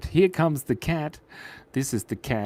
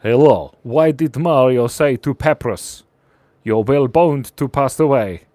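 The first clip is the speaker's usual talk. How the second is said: put-on Russian accent; 3 semitones lower, 4 dB louder than usual. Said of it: "I'll do it in a Russian accent".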